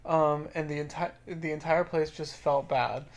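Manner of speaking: quiet, even reading voice; reading a stranger's story aloud; reading a comment aloud. A narrator's reading. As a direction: read the post out softly. Reads a Reddit comment.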